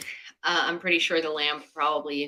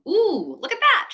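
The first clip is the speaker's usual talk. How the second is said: delighted surprise; 13 semitones higher, 5 dB louder than usual. Real, not acted. Camera lighting suddenly looks moody.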